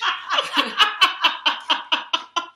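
real loud laugh, high; loud burst of laughter. Real, not acted. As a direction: crack up at the punchline. Laughing after reading email.

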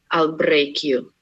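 low, menacing Russian spy voice; Russian accent. Shown as a demonstration. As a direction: threaten slowly in a Russian accent. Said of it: "I have one phrase that always works".

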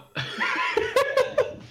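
shrieking breathless laugh; cracking up in a wheezy laugh; high wheezing laugh. Real, not acted. Laughing fit at bad movie.

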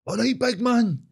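high-pitched mocking greeting; obnoxious gym bloke. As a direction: mimic an overfamiliar gym pest hailing a mate. Mimics arsehole from gym greeting him.

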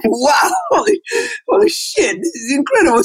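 stunned, half-shouted wow; shocked. Retold or imitated. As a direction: yelp it out like you can't believe it. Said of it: "I'm sitting there in shock going".

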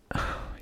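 short, soft sigh; soft exasperated exhale. A narrator's reading. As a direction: let out a quiet breath before arguing. Character sighs before retorting.

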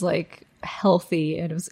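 breathy whisper aside. Real, not acted. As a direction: whisper it in disbelief. Aside about old radium beliefs.

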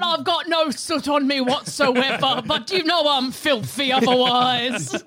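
chimney sweep in Cockney; Cockney accent. Pretend. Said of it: "worst Cockney accent".

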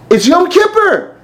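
high, loud and slow; exclaimed. Retold or imitated. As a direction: stretch it out in shock. Rabbi exclaims.

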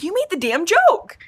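high-pitched playful protest; mock indignation. Friend protests about who made the joke, mock indignant. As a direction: throw the blame back, squeakily indignant.

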